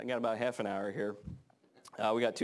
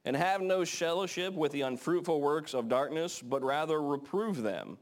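slow, higher reading of the verse; scripture reading; 4 semitones higher than usual. Acted. Preacher reading Ephesians to the congregation, slow and raised. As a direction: read it out slowly and raised.

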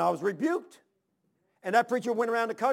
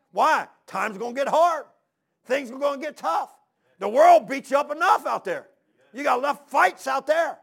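loud, raised, emphatic pulpit delivery; forceful preaching; 5 semitones higher, 7 dB louder than usual. Real, not acted. Preacher urging church to exhort each other, forceful preaching. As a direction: drive each phrase home louder and higher.

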